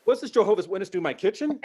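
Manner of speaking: high, loud shocked yelling across the room. Retold or imitated. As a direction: yell in disbelief. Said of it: "you hear me yelling".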